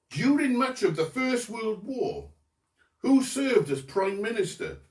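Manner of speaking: usual speaking voice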